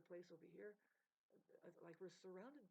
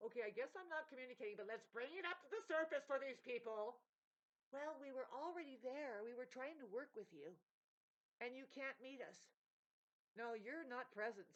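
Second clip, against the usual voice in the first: heated retold argument; 6 semitones higher, 6 dB louder than usual. Acted quarrel with the other side.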